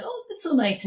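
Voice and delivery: high, delighted little gush; retelling a sweet gushing reply; pleasantly surprised. Retold or imitated. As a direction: coo it like you're touched. Touched reply to John's request.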